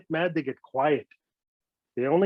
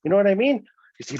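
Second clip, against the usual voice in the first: usual speaking voice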